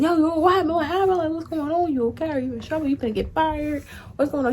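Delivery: high, urgent worried asking; retold panicked concern for a friend; worried rapid questions. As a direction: pepper him with anxious questions. Anxious questions to coworker Dez.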